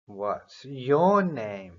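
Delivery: slow, clear "What's your name?" with stress on "your"; a falling "What's your name?", each word spaced; slow model question. Shown as a demonstration. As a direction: model it slowly for repeating.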